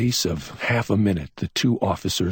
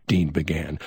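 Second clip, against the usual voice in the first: plain reading voice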